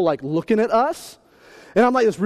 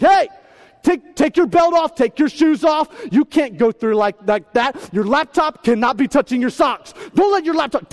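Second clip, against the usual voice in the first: higher, loud barked orders; mean agent; 6 semitones higher, 4 dB louder than usual. Retold or imitated. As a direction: bark rules at a traveler. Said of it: "They're just mean".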